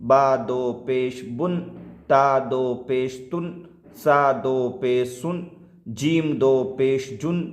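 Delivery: slow measured recitation, "دو پیش" drawn out; spelling-out drill. Shown as a demonstration. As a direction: stretch each letter name, lift the final syllable as in "بن". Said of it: "اس کو پڑھیں گے". ("دو پیش" کھینچ کر، ٹھہر ٹھہر کر ہجے)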